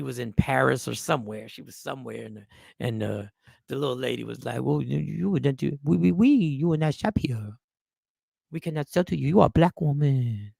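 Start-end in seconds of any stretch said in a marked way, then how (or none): 4.6-7.5 lightly attempted French woman's accent. French shopkeeper turning away a customer, mock French accent. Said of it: "my French woman". 8.5-10.5 French accent slipping toward mock Chinese; botched French accent. French saleswoman refusing Oprah, muddled French accent. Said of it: "turned into a French woman and a Chinese woman".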